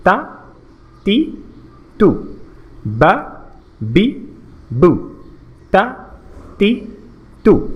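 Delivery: তা, তি, তু, বা, বি, বু with very brief vowels; quick short syllables. Shown as a demonstration. Teacher shows how quickly to say them, তা, তি, তু each cut short. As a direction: drill তা, তি, তু and বা, বি, বু as quick separate syllables. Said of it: "খুব দ্রুত করতে হবে".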